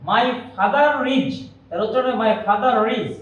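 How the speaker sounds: father reads with the R clearly sounded, slowly; teacher stretches my father reads so the R is heard; linked R, slow. Shown as a demonstration. Teacher to students, slowly sounding the R in father reads. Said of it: "এই রটা যেন শোনা যায়".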